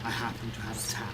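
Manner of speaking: low, quick, sly aside; sly guardian voice. Acted. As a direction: play the devious guardian, dry.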